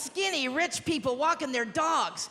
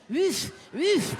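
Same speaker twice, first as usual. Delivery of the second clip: French dog bark. Retold or imitated. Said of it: "it actually barked with a French accent".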